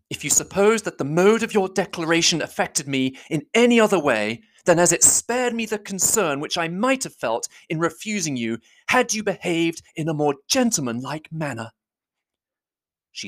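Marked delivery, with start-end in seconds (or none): 0.1-11.7 higher voice held in check; a higher heroine's voice, restrained but firm; Elizabeth, forced composure. Acted. Elizabeth refusing Darcy, higher and tightly composed. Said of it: "speak with composure".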